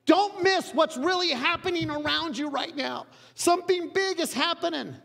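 father urgently begging his son; pleading father. Retold or imitated. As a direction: beg him not to miss it. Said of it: "He's like".

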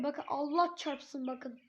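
ordinary delivery

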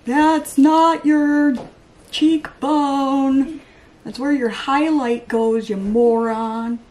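loud, drawn-out mock scolding; worked up. Vlogger telling off bad contourers, worked up. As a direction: scold them slowly and loudly, word by word. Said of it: "I get a little worked up".

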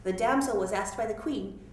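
ordinary delivery, nothing marked

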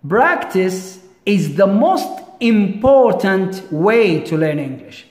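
deliberate teacher's model sentence, leaning on key words; slow example reading. Shown as a demonstration. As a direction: read very slowly, word by word.

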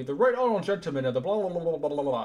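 rapid mock parliamentary babble; Boris Johnson talking super fast. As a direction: race through it, tumbling into a blur of syllables. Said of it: "talks super fast".

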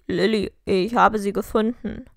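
deep-pitched, forceful letter voice; letter writer's voice. A narrator's reading. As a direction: read the letter out low and loud.

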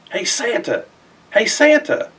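put-on child calling to Santa, hoping he's okay. A narrator's reading. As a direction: call up into the night sky.

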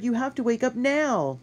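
high, loud plea to wake; urgent shout. A narrator's reading. Boy trying to wake his friend, high urgent shout. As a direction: shout to rouse someone. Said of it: "he shouted".

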